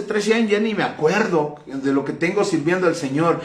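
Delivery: usual speaking voice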